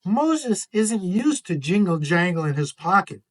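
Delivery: usual speaking voice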